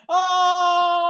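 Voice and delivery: a sudden high loud "Oh!"; mock shout. Mocking a movie extra, a high loud shout. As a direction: yelp it out like a startled extra.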